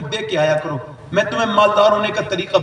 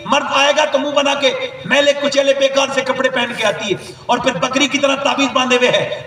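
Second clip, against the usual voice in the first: ordinary talk, nothing marked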